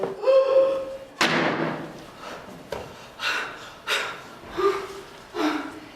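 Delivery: a sharp breathless gasp of horror; long shocked gasp. Acted. Someone lifts the window seat cover, shocked gasp. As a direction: gasp as if finding a body. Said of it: "and say".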